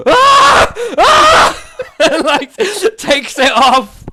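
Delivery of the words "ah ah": high shrill yelps, very loud; shrieks of pain. Acted. Shopkeeper shrieking as acid burns her hand. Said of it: "she just looks at it and goes".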